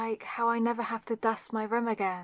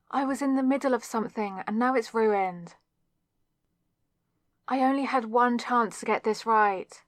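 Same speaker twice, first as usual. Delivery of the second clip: louder, indignant telling-off; angry scolding; 5 dB louder than usual. Acted. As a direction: scold the intruder who spoiled it all. Intruder ruined her spell.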